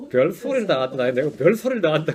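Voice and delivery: ordinary delivery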